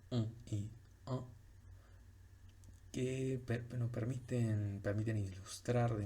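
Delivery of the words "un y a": un y a said in French, nasal vowel in un; French example words. Shown as a demonstration. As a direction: read un y a as French sounds. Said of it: "son dos palabras".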